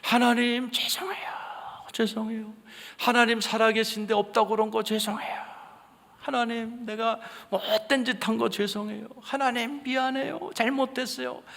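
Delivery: high, wailing, tearful cries to God; sobbing repentance. Retold or imitated. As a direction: cry out in remorse, voice straining high. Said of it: "우는 거예요". (울부짖으며 회개하는 목소리)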